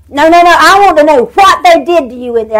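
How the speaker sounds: lightly voiced woman who won't take no; a hint of an urgent stranger pushing for the truth; insistent woman. Insistent woman running up to car.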